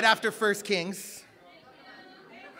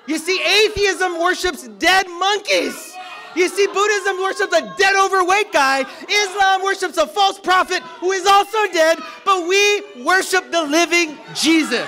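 high, shouted sermon climax; full-force preaching; 7 semitones higher, 8 dB louder than usual. Real, not acted. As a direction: proclaim it loudly to the whole congregation.

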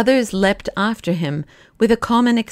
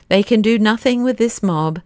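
usual speaking voice

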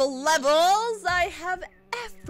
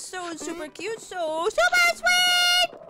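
very high, slow cutesy squeal; 9 semitones higher, 3 dB louder, slower than usual. Acted. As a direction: stretch it out in a squeaky cute voice. Squeals about cute game.

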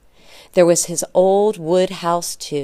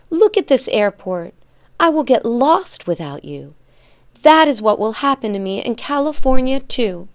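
louder, higher, distressed outburst; upset cry; 6 semitones higher, 6 dB louder than usual. A narrator's reading. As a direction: protest anxiously like an upset boy. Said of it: "cried Janek".

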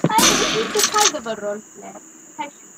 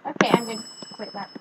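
ordinary talk, nothing marked

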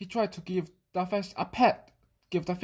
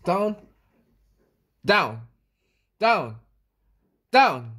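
falling down; 6 dB louder, slower than usual. A narrator's reading. Cat falling down.

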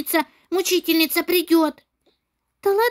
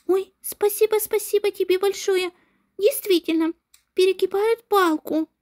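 ordinary delivery

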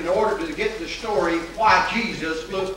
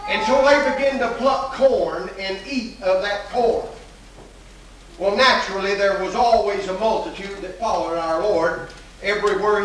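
preaching; 4 semitones higher than usual. Acted. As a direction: preach it out to the congregation. Preacher builds to full force.

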